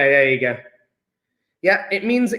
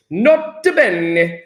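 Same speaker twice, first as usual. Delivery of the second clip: slow, drawn-out Italian accent; hammed-up Italian accent; much slower than usual. Shown as a demonstration. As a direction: roll it out slowly as a mock Italian. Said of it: "say it with an Italian accent".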